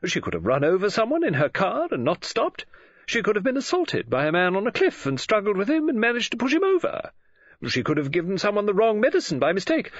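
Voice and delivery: high-pitched, eager run of guesses; brightening, imaginative. A narrator's reading. Novelist spins murder theories to the detective, brightening. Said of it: "began to brighten as she set her ever-prolific imagination".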